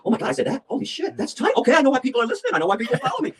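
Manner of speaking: high, gasping astonishment at himself; excited high voice, replaying the moment of discovery; retold amazement. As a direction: act out being blown away by your own work. Amazed watching own old speeches.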